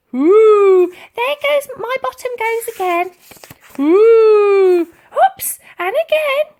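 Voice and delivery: an old lady pretending her bottom misbehaves; hammed-up loud hoots; loud whooping hoots. A narrator's reading. Dotty fakes flatulence.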